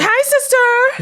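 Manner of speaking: very high, drawn-out sing-song "Hi, sister!"; James Charles greeting. Retold or imitated. Ghost of James Charles greets the lonely girl, high sing-song. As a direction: squeal it like a bubbly beauty vlogger. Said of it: "I don't know what James Charles sounds like".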